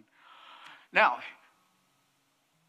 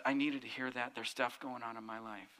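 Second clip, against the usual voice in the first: lower, much softer, a touch of tearful relief; mild retold tearful; 7 semitones lower, 9 dB quieter than usual. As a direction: quietly voice the psychic moved to tears. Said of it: "The woman starts crying".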